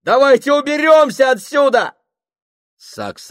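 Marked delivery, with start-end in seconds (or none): 0.0-1.9 high, loud desperate yell; shouting. Acted. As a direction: bellow to get everyone out. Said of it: "закричал он на них".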